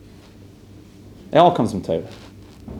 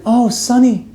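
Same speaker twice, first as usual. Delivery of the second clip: high, louder cry of greeting; excited greeting; 12 semitones higher, 3 dB louder than usual. Retold or imitated. Father greets his son at the door, high excited. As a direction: squeal it out in welcome.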